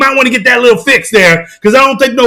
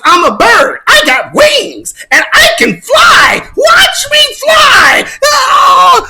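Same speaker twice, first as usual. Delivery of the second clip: mock bird-man; 13 semitones higher, much slower than usual. Vlogger mocking people who think they fly, screeching high. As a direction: squeal it like a fool leaping off a roof.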